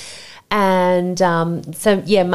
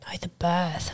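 soft, unvoiced aside; whispered correction; 10 dB quieter, slower than usual. Friends correcting her mix-up, a soft whisper. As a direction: whisper the obvious.

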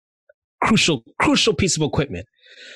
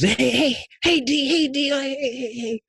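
put-on kids calling out; 13 semitones higher than usual.

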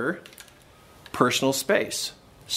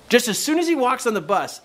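higher, insistent retold advice; pressing instruction, pitched up; urging dad; 8 semitones higher, 4 dB louder than usual. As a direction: coach the boy like an eager dad. Dad urging his son in retold talk.

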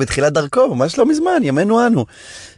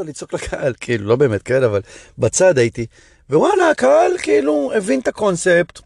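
usual speaking voice

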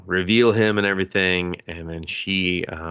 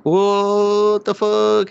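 much higher, louder, very slow, drawn out; drawn-out high loud mock shock; shocked together; 13 semitones higher, 7 dB louder, much slower than usual. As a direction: stretch the shock out long. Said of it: "they're both like".

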